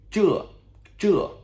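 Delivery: 这 high and loud, dropping sharply each time; fourth-tone 这. Shown as a demonstration. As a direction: repeat 这 with a descending tone. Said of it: "fourth tone descending tone".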